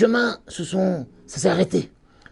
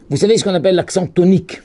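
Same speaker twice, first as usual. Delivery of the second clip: normal talking voice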